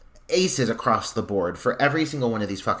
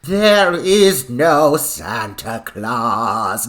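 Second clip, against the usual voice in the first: very slow, louder, put-on demanding old woman with an accent; attempted nun voice; 7 dB louder, much slower than usual. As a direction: drag each word out like a severe old nun. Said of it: "I can't do it either".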